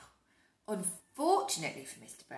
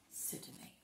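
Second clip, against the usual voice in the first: usual speaking voice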